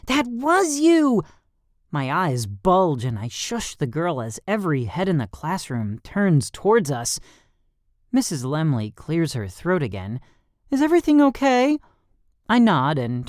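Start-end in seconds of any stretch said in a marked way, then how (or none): 0.1-1.2 high, loud, slow exclaim; shouted. A narrator's reading. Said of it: "Riley gasps and shouts". 10.7-11.8 higher, brisk teacher voice. A narrator's reading. Teacher voice in story.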